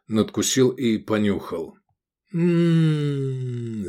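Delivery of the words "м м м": long, high sliding hum of delight; exaggerated rapturous mmm. A narrator's reading. Diner sniffing a pie, exaggerated delighted hum. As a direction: hum in put-on ecstasy. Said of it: "в нарочитом восторге".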